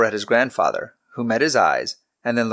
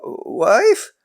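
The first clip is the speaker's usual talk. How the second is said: high, louder mock-surprised question; feigned surprise; 13 semitones higher, 5 dB louder than usual. A narrator's reading. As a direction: act surprised, not quite convincing. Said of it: "trying to sound surprised".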